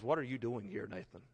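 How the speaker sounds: soft comforting question, barely voiced; gentle whisper. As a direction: speak it close and tender, as God to you. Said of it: "When he says".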